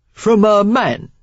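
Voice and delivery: sly Cockney rogue; Cockney accent. A narrator's reading. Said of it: "Cockney in his accent".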